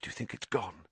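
soft hushed question; Harry whispers to Ron in the dark forest; whispered. A narrator's reading. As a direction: whisper it, afraid to be heard. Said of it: "Harry whispered".

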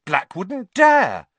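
loud, high, defiant confidence; plucky bravado, slow and emphatic; spirited. A narrator's reading. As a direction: stretch it out with bold spirit. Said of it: "said Ron spiritedly".

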